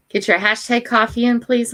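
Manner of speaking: ordinary talk, nothing marked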